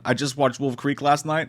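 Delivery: ordinary talk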